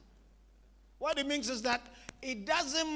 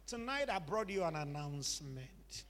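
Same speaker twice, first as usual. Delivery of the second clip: normal talking voice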